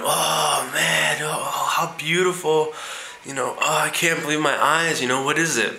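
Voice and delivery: lightly voiced tourist gaping at the Duomo; amazed American tourist. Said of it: "the tourist says".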